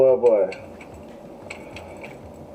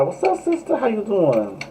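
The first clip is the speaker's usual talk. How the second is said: playful greeting; 9 semitones higher than usual. Pretend.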